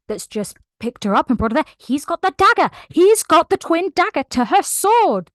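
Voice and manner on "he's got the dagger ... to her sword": high, loud, thrilled telling; excited. Real, not acted. Fan thrilled retelling the book's twist, excited. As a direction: burst out with the big reveal. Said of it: "excited retelling of twist".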